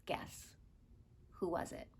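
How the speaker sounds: hushed teasing whisper. Acted. Teacher teases the children to guess who, whisper. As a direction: breathe it as a secret.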